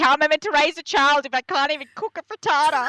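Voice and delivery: hammed-up panic about cooking; laughing wail. Wailing to husband about a cold frittata, laughing. Retold wail over ruined meal.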